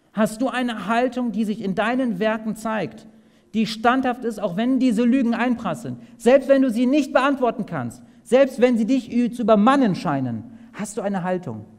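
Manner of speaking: swelling urgency; building appeal. Real, not acted. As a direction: press the question home harder.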